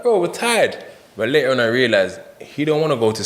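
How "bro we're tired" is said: high-pitched, exasperated mock plea; weary protest. Tired inmate answering his restless cellmate, high-pitched protest. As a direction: imitate pleading with a cellmate, voice jumping up.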